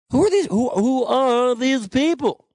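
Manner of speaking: high, slow, incredulous Seinfeld whine; Jerry Seinfeld impression. Host quoting Jerry Seinfeld on donors, high Seinfeld whine. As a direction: stretch it out like Seinfeld's bit. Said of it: "To quote Jerry Seinfeld".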